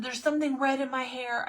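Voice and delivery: high-pitched, urgent re-enacted alarm; rising panic, played for the story; mock panic. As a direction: retell it as a panicked plea. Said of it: "full on panic".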